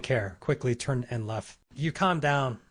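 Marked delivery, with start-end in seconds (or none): none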